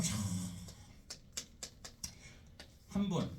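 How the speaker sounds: normal talking voice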